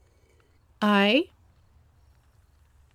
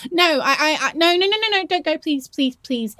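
higher, rushed pleading; panicked pleading; 7 semitones higher than usual. Acted. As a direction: beg them not to leave. Begging partner not to leave.

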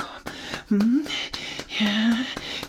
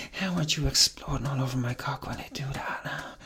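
normal talking voice

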